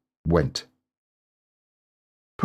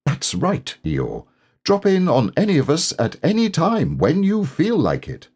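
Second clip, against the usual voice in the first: brisk, higher busy rabbit voice; higher, bossy rabbit; 9 semitones higher than usual. Acted. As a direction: bustle through it like a busy rabbit. Rabbit advising Eeyore briskly.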